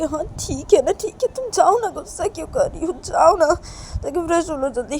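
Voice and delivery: high, loud, protesting plea; upset urging. Acted. Reshma asks why Kajal is angry, upset urging. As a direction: plead back at full pitch.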